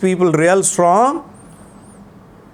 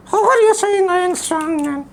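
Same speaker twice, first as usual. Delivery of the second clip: put-on puppet boy voice. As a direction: play the cheeky little boy puppet. Puppet child voice.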